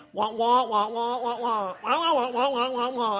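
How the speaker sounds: imitated muted-trombone grown-up babble; Charlie Brown wah-wah. Parents talking as the child tunes out, droning wah-wah noise. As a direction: drone wordless wahs like a cartoon adult. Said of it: "the Charlie Brown kind of thing".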